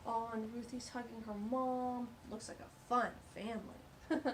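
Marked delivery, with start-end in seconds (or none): none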